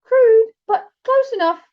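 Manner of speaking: drawn-out high trill, giving grudging approval; high slow door knocker. A narrator's reading. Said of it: "let me do it again".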